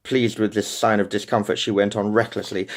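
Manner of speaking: usual speaking voice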